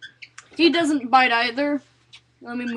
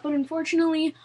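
ordinary delivery

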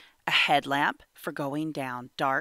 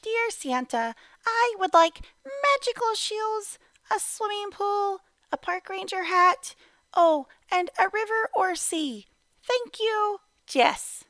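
put-on much higher, squeaky little-kid voice; tiny excited girl; 4 dB louder than usual. Little girl reading her wish list to Santa, very high and eager. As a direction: chirp it out like a very small girl. Letter read in Jess's voice.

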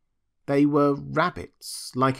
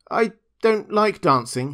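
lightly voiced rabbit, higher; a slightly high, defensive excuse; protesting little rabbit; 7 semitones higher, 4 dB louder than usual. A narrator's reading. Toy rabbit hiding that he cannot dance, defensive.

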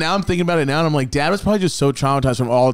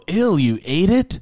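slow, raised, drawn-out disbelief; grossed-out question; 4 semitones higher, slower than usual. Retold or imitated. Kid seeing dad eat the bone, grossed out. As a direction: recoil and ask it slowly. Child grossed out, misheard as idiot.

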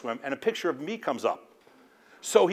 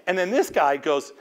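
ordinary talk, nothing marked

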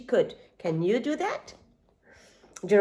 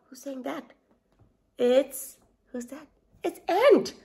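ordinary delivery

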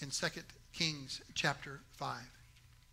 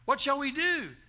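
panicked plea; 7 semitones higher, 6 dB louder than usual. Retold or imitated. Assistant wakes Elisha at dawn, alarmed cry. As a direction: plead with the master in alarm. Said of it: "the assistant runs".

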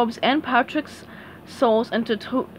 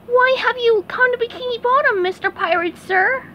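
high, polite nervous question; SpongeBob voice; 12 semitones higher, 4 dB louder than usual. Acted. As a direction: ask politely while scared. SpongeBob cowering before ghost.

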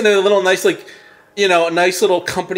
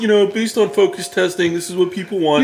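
higher, fake-cheerful company spokesman; mock corporate voice; 5 semitones higher than usual. Mocking a subscription company to friends, fake corporate cheer. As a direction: parody the company's sales pitch.

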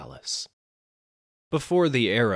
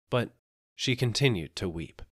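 ordinary delivery

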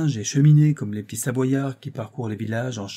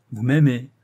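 loud, jeering retort; mocking; 2 semitones higher, 7 dB louder than usual. A narrator's reading. Actress to the lovesick financier, mocking. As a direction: scoff at the declaration. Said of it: "railla la comédienne".

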